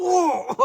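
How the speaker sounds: loud high burst of laughter. Real, not acted. Laugh amid retold anger.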